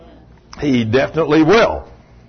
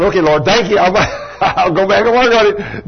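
laughing thanks; 5 semitones higher than usual. Retold or imitated. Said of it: "I said".

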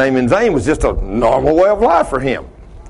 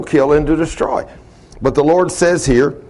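ordinary delivery

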